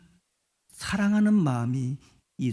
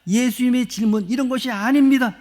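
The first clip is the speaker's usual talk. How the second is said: normal talking voice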